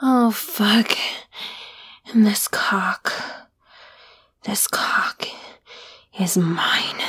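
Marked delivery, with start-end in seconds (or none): 2.1-7.0 low, unvoiced possessive whisper. Claiming her partner, a low possessive whisper. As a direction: claim him in a hushed breath.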